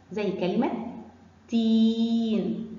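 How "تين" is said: very slow, long-held ee in تين; lengthened ee. Shown as a demonstration. Teacher asks children to hear the length, long ee in تين. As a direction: stretch the vowel of تين right out. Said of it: "سامعين صوت التاء بطوله ازاي بالكسره؟".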